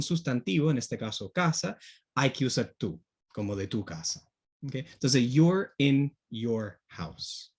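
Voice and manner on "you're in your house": "you're in your house" very slow, no difference between "you're" and "your"; slow teacher model; slow demo sentence. Shown as a demonstration. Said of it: "No hay diferencia ahí".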